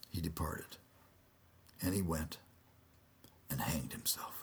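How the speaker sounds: soft, low whispered ending; hushed whisper. A narrator's reading. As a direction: let it fade into a whisper. Narrator tells Judas' death.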